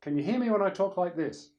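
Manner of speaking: loud, high, pitched to the back; projected voice check. Shown as a demonstration. Speaker checking with mates at the back, projecting loudly. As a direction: project it across the room. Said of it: "being able to pitch your voice".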